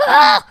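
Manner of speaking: sudden loud yelp of alarm; startled loud cry. Real, not acted. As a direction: jump at the shock and yell. Startled at alien in base.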